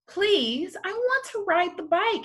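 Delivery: high, quick eager plea; pleading boy. A narrator's reading. Boy begs Whoosh to let him ride, eager plea. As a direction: beg for the bike, rushing the words.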